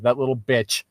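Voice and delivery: usual speaking voice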